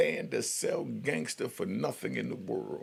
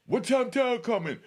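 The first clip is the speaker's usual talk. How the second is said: high, louder mock holler; imitated yell; 12 semitones higher, 5 dB louder than usual. Inmate yelling over the mic, mock holler. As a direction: yell it through the door. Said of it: "he might yell".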